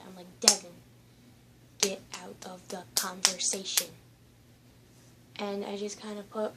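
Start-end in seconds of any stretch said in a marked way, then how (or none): 0.4-3.9 name yelled much louder, then firm; angry snap. Retold or imitated. Girl shouting at Devin to stay out of the argument, angry. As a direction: cut him off sharply by name. Telling off friend during lockdown.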